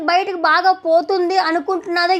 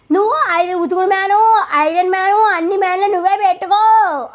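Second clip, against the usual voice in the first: higher, louder mocking shout; imitating a yell; 4 semitones higher, 3 dB louder than usual. Reviewer mimicking Ayesha yelling at Kalyan about ironing, mock shout. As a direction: fling it back at him mockingly. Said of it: "అని అరుస్తుంది పెద్ద పెద్దగా".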